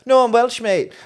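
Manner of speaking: high, loud imitated fan reply; emphatic retort. Welsh fan answering a hunting reporter, emphatic retort. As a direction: protest it brightly and loudly.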